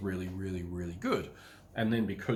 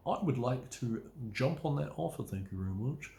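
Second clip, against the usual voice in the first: prim polite buyer; 3 semitones higher than usual. Retold or imitated. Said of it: "And they always talk like that as well".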